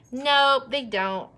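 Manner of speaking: ordinary talk, nothing marked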